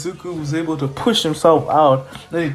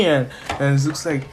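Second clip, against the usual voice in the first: ordinary delivery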